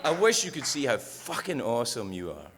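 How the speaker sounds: normal talking voice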